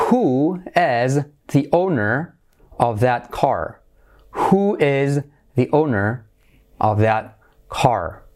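deliberately slow model reading, word by word. Shown as a demonstration. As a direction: spell the question out slowly for learners. Option read word by word. (lectura lenta, palabra por palabra)

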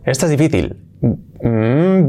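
normal talking voice

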